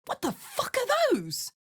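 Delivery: high startled outburst; shocked. Acted. Seeing unknown monsters, a high shocked question. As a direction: recoil in disbelief.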